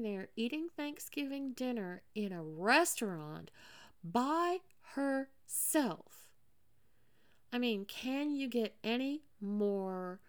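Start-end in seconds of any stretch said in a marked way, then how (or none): 4.0-6.3 anguished. Real, not acted. Self-pity peaking on a word.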